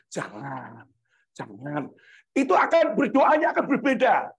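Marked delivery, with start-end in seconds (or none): 0.1-1.9 low, soft warning voice; Holy Spirit's warning. Acted. Holy Spirit warning someone near sin, low hushed voice. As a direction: drop low and hush a gentle caution. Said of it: "diingatkan oleh Roh Kudus".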